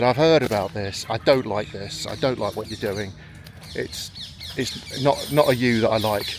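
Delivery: telling off. Retold or imitated. Pub friend telling the speaker off for quitting, slow scolding. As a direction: lay into him like a disappointed mate. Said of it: "he really let me have it".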